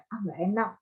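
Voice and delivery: normal talking voice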